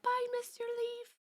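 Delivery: put-on high, soft small-child voice; a tiny, soft imitation kid goodbye; little cartoon kid. Imitating cartoon child as bug flies away, little kid voice.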